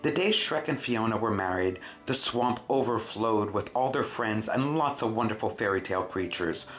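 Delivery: ordinary delivery, nothing marked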